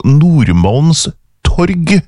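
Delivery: Nordmalms torg very slow, as two separate words; sounding out. Retold or imitated. Host working out the place in Stockholm, slow sounding out.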